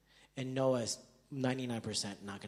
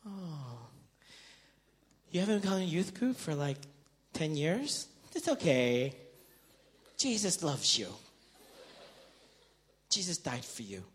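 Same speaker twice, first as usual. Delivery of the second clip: always-comforting friend; 3 semitones higher than usual. Acted. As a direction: console them gently, overly understanding. Acted always-comforting friend, contrast.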